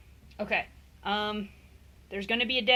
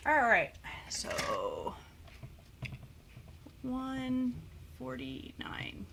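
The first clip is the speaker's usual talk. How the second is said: whispering; 3 semitones higher, 6 dB quieter, much slower than usual. Real, not acted. Reading the scale.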